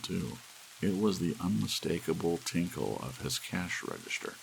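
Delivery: usual speaking voice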